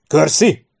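high, loud call of a name; shout. A narrator's reading. Officer hailing a major in battle, shout. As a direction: yell out to get his attention. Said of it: "kiáltotta Sharp".

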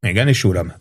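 ordinary delivery

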